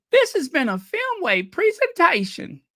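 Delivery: high announcer line; mock last words. Repeating the joke to viewers, high mock announcer. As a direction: say it again, high and sing-song. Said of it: "Ellie Mae's last words".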